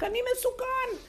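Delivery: high, loud cry of danger; imitating the rabbi's cry; mock shout. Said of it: "רב אושר היה צועק".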